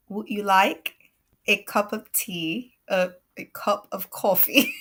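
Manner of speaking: slow, halting go at a British accent; badly faked British accent, tipping into laughter; hesitant British imitation that drifts toward New York; attempted British accent. Shown as a demonstration. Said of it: "I'll try to do the British".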